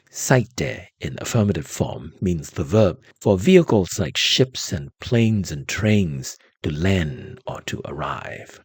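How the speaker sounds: usual speaking voice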